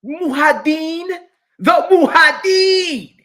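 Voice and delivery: Muhaddin slow, high and shouted in a put-on Arabic accent; mocking a convert's Arabic accent, shouted.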